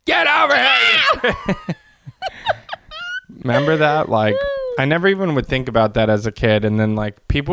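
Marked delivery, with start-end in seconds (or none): 0.0-1.5 much higher, slow laughing shout; mock shout. Threatening a St Patrick's pinch, laughing mock shout. 2.1-3.2 high soft giggle; high squealing laugh. Real, not acted. Laughing at the pinch joke, high squeal. After mock pinch threat.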